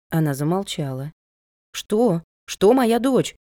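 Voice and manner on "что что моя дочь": higher, louder worried question; raised pitch with sudden fright; alarmed. A narrator's reading. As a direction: demand to know, startled. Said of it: "испугалась я".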